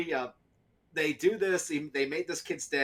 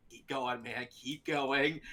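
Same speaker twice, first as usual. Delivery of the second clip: soft, low egging on; hushed urging; 3 semitones lower, 6 dB quieter, slower than usual. Retold or imitated. Animators in his head urging Steve on, softly. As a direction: egg him on under your breath. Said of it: "animators ... are going".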